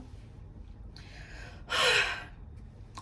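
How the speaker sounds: long, heavy exhale; composing sigh. Acted. Promised not to cry over her friend, long steadying sigh. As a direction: breathe out to steady yourself. Composing herself after near tears.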